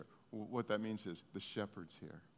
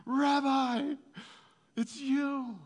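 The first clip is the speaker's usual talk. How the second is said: higher and louder, a burst of glad recognition; joyful recognition; 10 dB louder, much slower than usual. Retold or imitated. Mary recognizing Jesus at the tomb, overjoyed and much higher. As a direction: cry out as if you've just seen him alive.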